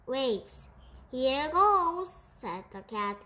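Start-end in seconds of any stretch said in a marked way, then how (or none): 1.1-2.1 higher, louder launch cry; eager exclamation. A narrator's reading. Cat setting off in the boat, eager exclamation. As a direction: call it out as you push off.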